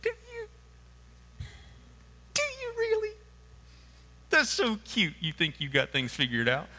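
high sing-song condescension; teasing Jesus. Retold or imitated. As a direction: coo at them like a child. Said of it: "Jesus looks at you and goes".

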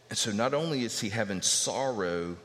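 ordinary delivery

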